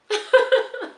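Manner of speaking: high, loud burst of laughter; emotional laugh. Real, not acted. Nurse getting teary-eyed over preceptor, bursts out laughing. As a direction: laugh off the welling tears.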